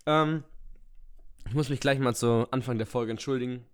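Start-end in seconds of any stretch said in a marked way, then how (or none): none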